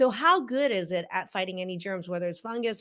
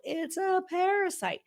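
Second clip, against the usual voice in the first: high, slow breathless mock hype; 11 semitones higher than usual. Parodying the show announcing the big parasite finding, mock dramatic. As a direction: ham up the big reveal as a shocking parasite. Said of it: "they're touting it as".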